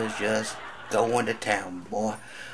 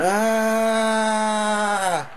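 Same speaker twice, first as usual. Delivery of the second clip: much higher, louder sharp cry of pain; doctor's pained yelp; 13 semitones higher, 7 dB louder than usual. Acted. Bitten doctor crying out, high and loud. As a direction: yelp like you've been bitten. I heard the doc, he went.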